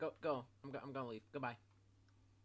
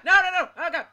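high, loud frightened pleading; terrified gibbering; 6 semitones higher, 13 dB louder than usual. Real, not acted. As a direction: cry out no in real panic. Monster appears.

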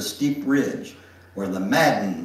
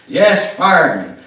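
slow, loud and higher confirmation; grim confirmation; 6 semitones higher, 11 dB louder than usual. Acted. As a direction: press the hard fact home. Father confirms grimly.

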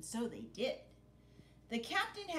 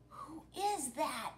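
fearful question; 6 semitones higher, slower than usual. Acted. Frightened pirate asks.

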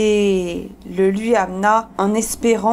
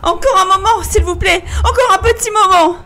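high, loud, hurried begging; panicked pleading; 10 semitones higher, 4 dB louder, faster than usual. Acted. Wife begging her husband for another moment, panicked. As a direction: beg frantically for a delay.

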